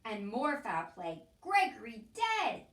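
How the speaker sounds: loud, slow shout. A narrator's reading. Cook shouting the news of Gregory's death, loud. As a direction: cry it out in alarm. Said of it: "shouted Cook".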